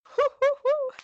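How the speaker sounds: high doting granny chuckle; Nana voice. Retold or imitated. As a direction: chuckle like a proud little old grandma. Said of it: "It's like Nana".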